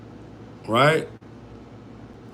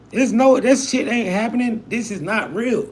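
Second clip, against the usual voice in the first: incredulous refusal; 8 semitones higher than usual. Pretend. Refusing a day without pay.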